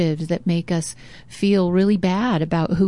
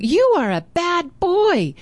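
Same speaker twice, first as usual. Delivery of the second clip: put-on high and slow, a boy calling an insult; mock scolding call; 10 semitones higher, slower than usual. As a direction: taunt the stranger in the woods. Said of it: "So he's hearing the echo".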